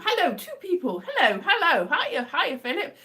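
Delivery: high, loud excited hellos; excited greeting. Real, not acted. Streamer greets two viewers who joined, excited greeting. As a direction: greet arrivals with a burst of excitement.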